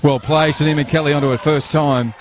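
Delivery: excited call. Real, not acted. Commentator calling the youngster's first-time volley, excited. Goal chance, commentator calls it.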